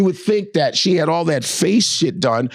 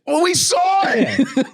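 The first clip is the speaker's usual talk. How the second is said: much higher, slow, laughing shout; mock shout; 12 semitones higher, slower than usual. Comic mocking crew leaving capsule, laughing shout. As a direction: bellow it like a giddy tourist.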